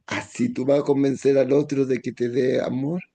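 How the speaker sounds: normal talking voice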